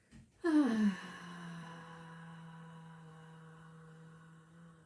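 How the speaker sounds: long voiced sigh, falling away; big sigh. Shown as a demonstration. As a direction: let out a big releasing sigh. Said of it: "It's a big sigh".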